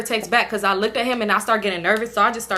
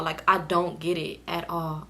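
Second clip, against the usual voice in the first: softer and slower, trailing off in confusion; confused, fading; 4 semitones lower, 7 dB quieter than usual. Real, not acted. To viewers, baffled, saying it again softer and slower. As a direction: let the words sink away, baffled. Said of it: "I was so confused".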